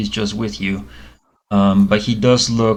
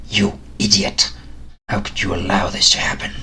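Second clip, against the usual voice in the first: whispered hiss, berating the clerk; hissing snake-man scolding; 3 dB quieter than usual. Acted. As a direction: spit the insult like an angry snake. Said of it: "is yelling at and berating".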